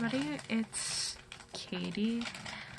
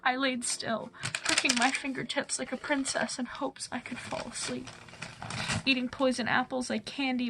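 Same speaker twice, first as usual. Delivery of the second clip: heartfelt spoken-word poetry performance; slam poem; 8 dB louder than usual. Acted. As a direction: recite it as slam poetry, building from subdued lines to driving, insistent runs.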